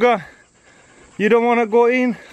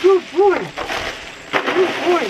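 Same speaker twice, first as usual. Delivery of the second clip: high dog praise; 9 semitones higher than usual. Real, not acted. Owner cheering her dog on the ice, high praise.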